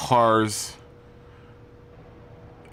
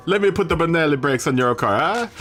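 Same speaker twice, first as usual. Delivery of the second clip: higher, hammed-up fake Italian accent; bad Italian accent; 7 semitones higher than usual. Streamer teasing a viewer about car brakes, hammed-up Italian accent. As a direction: oversell a silly Italian accent. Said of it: "the worst Italian accent I could ever make".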